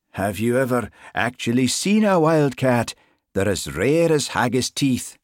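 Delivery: put-on Highland accent. A narrator's reading. Sergeant kidding constable about wildcats, Highland accent. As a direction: spin the tall tale in a Highland accent. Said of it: "His Highland accent always grew more pronounced when he was flustered".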